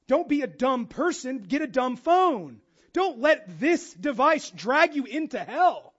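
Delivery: fiery sermon delivery; forceful preaching. Real, not acted. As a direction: hammer the point home to the congregation.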